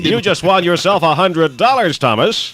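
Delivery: high, bright winner announcement; excited announcement. Real, not acted. Host telling caller Thomas he won, excited. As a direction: announce the prize with glee.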